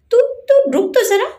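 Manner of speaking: playful high shout of mock anger; aunt playfully yelling at teasing boy; mock threat. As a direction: pretend to chase the cheeky boy. Playful chase after teasing.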